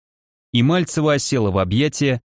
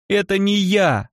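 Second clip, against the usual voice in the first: desperate denial; 8 semitones higher, slower than usual. Acted. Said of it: "замотала головой Мальцева и расплакалась".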